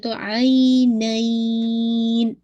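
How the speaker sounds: आई नाइन with the नून held clear at the end; clear noon at the stop. Shown as a demonstration. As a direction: recite slowly, ring out the final नून. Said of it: "नून को वाजे करना है". (आख़िर में नून साफ़)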